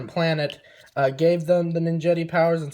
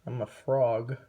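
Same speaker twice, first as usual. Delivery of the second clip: lightly voiced gloomy frog, a bit softer; depressed frog; 3 semitones lower, 4 dB quieter than usual. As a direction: mope it out like a sad frog. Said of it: "He sounded so depressed in the movie".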